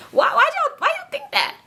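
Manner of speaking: very high, squeaky disbelief; incredulous squeal. Pretend. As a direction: squeak the question in mock bewilderment. Incredulous question to viewers.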